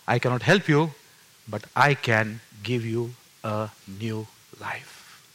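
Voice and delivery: soft, low, very slow pronouncement; second heavenly voice. Retold or imitated. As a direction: begin gently, then slow right down, word by word. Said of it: "Later on, the second voice came in".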